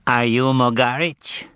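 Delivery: slow, nasal-sounding interrogator; Azazello voice. A narrator's reading. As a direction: drawl the question like a henchman.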